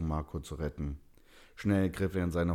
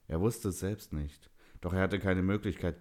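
plain reading voice

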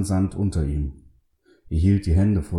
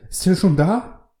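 high-pitched, alarmed question; startled, fearful high voice; horrified; 13 semitones higher, 4 dB louder than usual. A narrator's reading. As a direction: ask in sudden terror. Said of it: "Dann riss er die Augen entsetzt auf".

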